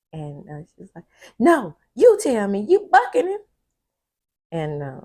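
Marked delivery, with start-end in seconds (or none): 1.4-3.4 loud, high, insistent demand; demanding outburst. Retold or imitated. Penelope demanding the truth from Andy, loud and high. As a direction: push hard for the answer, voice up and loud.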